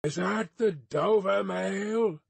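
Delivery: slow, high distant hail; drawn-out questioning shout; calling from afar. A narrator's reading. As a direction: call out through the mist. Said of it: "called from the mist".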